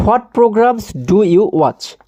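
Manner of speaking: very slow, each word separated for pupils; slow read-aloud. Shown as a demonstration. Teacher reading the English question to pupils, very slow. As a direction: spell the question out slowly like a teacher. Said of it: "teacher reads question slowly aloud".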